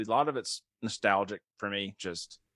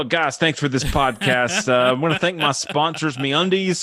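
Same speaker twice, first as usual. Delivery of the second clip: mock sponsor read; 4 semitones higher, 7 dB louder than usual. Mock podcast sponsor outro.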